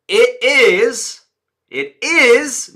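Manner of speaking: IS punched high and loud; emphasis on IS. Shown as a demonstration. Coach draws contrast with NOT, loud stress on IS. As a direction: hit IT IS hard, stretching IS. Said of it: "why am I emphasizing it is".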